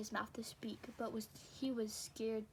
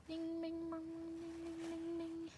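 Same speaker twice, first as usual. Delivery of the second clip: high sung ding of a clock; clock chimes; 6 semitones higher than usual. Acted. Narrator voicing the clock striking ten, high chimes.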